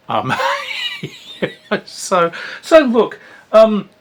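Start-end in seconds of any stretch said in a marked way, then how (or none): 0.4-1.8 high laugh. Real, not acted. Laughs at own joke.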